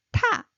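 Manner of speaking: 타 aspirated, high and loud; 타 with a strong puff of air. Shown as a demonstration. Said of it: "stronger one, rising".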